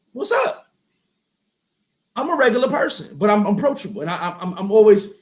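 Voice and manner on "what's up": very high-pitched, casual hello; friendly greeting. Retold or imitated. Showing how he greets people who approach him, friendly and high. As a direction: toss it up high and easy.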